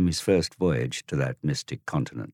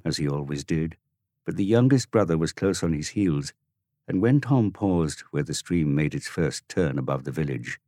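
ordinary delivery, nothing marked

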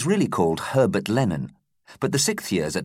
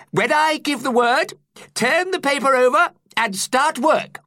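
high, louder teacher instructing; 6 dB louder than usual. A narrator's reading. Teacher telling pupils to start the test in a high voice. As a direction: give the class its orders kindly but firmly. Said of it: "he told them".

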